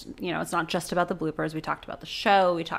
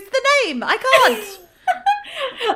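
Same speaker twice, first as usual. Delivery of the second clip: put-on much higher, loud British accent with a laugh; mock British accent; 5 dB louder, much slower than usual. Friend echoing the old actress in a British accent. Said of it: "I've got to come up with some excuse".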